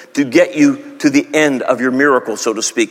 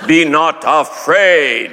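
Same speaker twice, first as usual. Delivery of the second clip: solemn King James Jesus; 2 semitones lower, much slower than usual. Pretend. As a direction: intone it like an old Bible film. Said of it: "I didn't say that".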